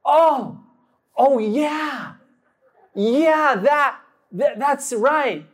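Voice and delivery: high, halting mock realization; sudden catching on. Buddy catches on to the cover story, hammed-up sudden realization. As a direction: act as if it just came back to you. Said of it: "all of a sudden he's like".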